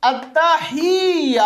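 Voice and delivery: التحیہ slow with a push on the ہ and the ت doubled; slow demo with stress on the ہ; jolt on ha. Shown as a demonstration. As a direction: press on the ہ in التحیہ, slowly. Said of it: "ہا پہ ہم تھوڑا سا زور لگائیں گے".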